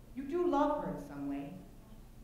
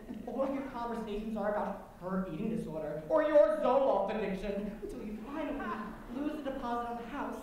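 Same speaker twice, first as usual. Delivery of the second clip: ordinary delivery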